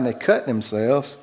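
ordinary delivery

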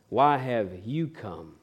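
slow and soft, gently asking; tender question. Acted. Jesus asking the betraying friend, slow and soft. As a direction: ask it softly, searching his face.

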